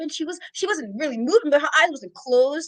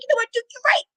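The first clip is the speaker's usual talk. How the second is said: grudging agreement; 11 semitones higher, much faster than usual. Retold or imitated. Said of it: "I was like".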